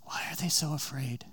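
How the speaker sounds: soft unvoiced whisper, drawn in; whispered question. Real, not acted. Preacher whispering the question to the listeners. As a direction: ask it in a hushed whisper.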